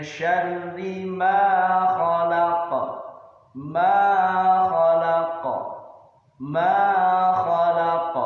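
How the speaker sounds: "ma" held long for two beats in a chant. Shown as a demonstration. Teacher showing the long ma, held chant. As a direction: stretch "ma" twice as long. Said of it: "panjang dua harokat".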